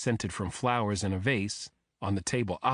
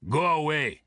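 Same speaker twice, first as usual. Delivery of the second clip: put-on higher, forceful thick Russian accent; thick-accented bark; 6 semitones higher, 4 dB louder than usual. A narrator's reading. Man barking through the hotel door at visitors, thick Russian accent. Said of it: "barked in a thick accent".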